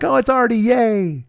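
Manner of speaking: high-pitched mock delight; silly high cheer, imitating fans. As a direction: gush like an adoring fan. Fans cheering Artie in retelling.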